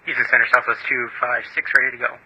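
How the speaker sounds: tinny radio transmission; crackly cockpit radio call; pilot over the radio. Real, not acted.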